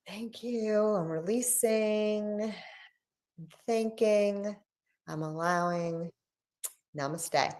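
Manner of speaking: slow, soft meditative voice; meditative release. Acted. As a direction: breathe the words out calmly. Closing energy release ritual.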